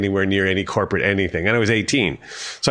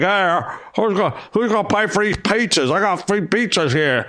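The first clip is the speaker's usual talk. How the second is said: much higher, hammed-up delivery guy voice; mock pizza delivery guy; 10 semitones higher than usual. Drummer joking to the receptionist, hammed-up pizza guy voice. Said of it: "I'm a pizza delivery guy".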